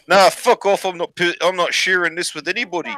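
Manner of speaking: high-pitched mock New Zealand accent; New Zealand bloke. Farmer refusing the blonde in a joke, mock New Zealand accent. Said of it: "the New Zealand bloke said".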